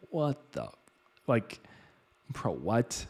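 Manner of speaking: hushed, baffled what the bro what; stunned disbelief. Retold or imitated. Storyteller reacting inwardly to the acupuncturist, hushed disbelief. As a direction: mutter it in quiet disbelief.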